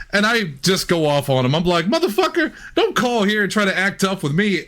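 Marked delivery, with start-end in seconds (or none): 1.8-4.6 higher-pitched retold tirade.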